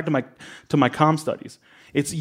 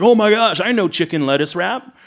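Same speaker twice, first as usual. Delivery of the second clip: mock thrilled customer, higher-pitched; excited recognition; 6 semitones higher, 4 dB louder than usual. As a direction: imitate a customer suddenly getting it, pitched up. Acting out customers' delighted recognition.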